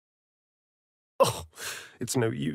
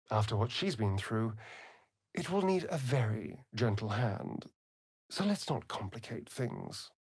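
hushed; 5 semitones lower, 10 dB quieter than usual. Acted. Companion speaking confidentially.

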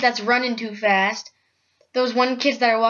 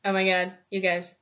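ordinary delivery

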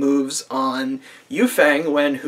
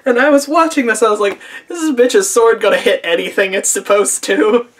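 high, loud, cracking-up disbelief; incredulous, near laughter; 7 semitones higher, 4 dB louder than usual. Real, not acted. Viewer reacting to yet another missed sword strike, incredulous, near laughter. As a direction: squeal it in exasperated amusement.

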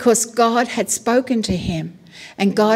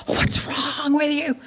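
strained, softer mock wail; exasperated parent; 3 semitones higher, 5 dB quieter than usual. Parent to a crying baby, exasperated. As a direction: plead in helpless exasperation. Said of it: "And you think".

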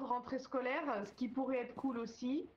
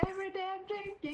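put-on higher, chanted Russian accent; 8 semitones higher than usual. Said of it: "Cet accent est raciste".

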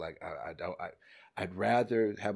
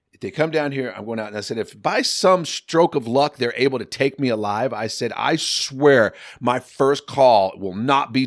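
loud, defiant threat, slowing on key words; furious threat; 14 dB louder than usual. Retold or imitated. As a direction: shout the ultimatum down the phone. Threatens to expose the affair.

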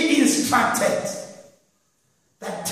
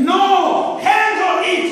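fervent preaching; 6 semitones higher than usual. Acted. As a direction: push the point home with fire. Preaching builds to a fervent peak.

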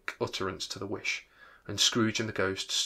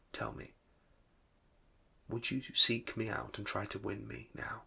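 whisper; 8 dB quieter than usual. A narrator's reading. Girl's plea read hushed.

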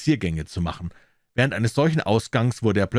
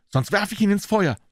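high, urgent woman's cry; high-pitched, worked-up ultimatum; agitated threat; 12 semitones higher than usual. A narrator's reading. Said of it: "rief sie erregt".

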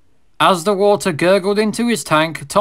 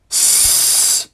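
swan hiss. Acted. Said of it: "said the swan".

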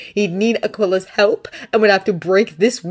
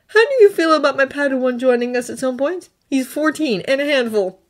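put-on higher, earnest young Jedi voice; Thonne's voice; 7 semitones higher than usual. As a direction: lift the voice up for Thonne, sincere and pleading. Thonne warns of Padawan.

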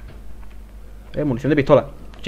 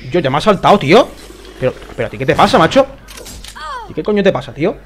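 loud, higher, indignant outburst; exasperated shouting; 4 semitones higher, 4 dB louder than usual. Real, not acted. Player shouting at the dog that jumped him in the game, exasperated. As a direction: yell at it in real exasperation.

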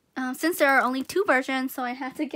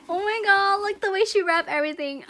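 high, loud burst of real excitement; overexcited, breaking into laughter; way too excited; 7 semitones higher, 5 dB louder than usual. Real, not acted. Unwrapping a friend's Christmas present, way too excited. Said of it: "I'm just way too excited right now".

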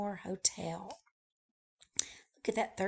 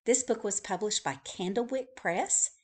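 ordinary delivery

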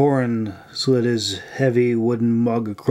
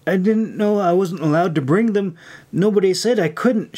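higher-pitched defensive plea; protesting excuse; 7 semitones higher than usual. A narrator's reading. May excuses herself to Irene, high protesting voice. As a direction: plead innocence in a raised voice. Child protests when scolded.

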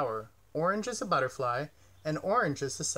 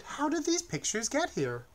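much higher, wondering question; delighted; 10 semitones higher than usual. A narrator's reading. Bird asks the lion about the pictures, high and delighted. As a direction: ask with wide-eyed delight.

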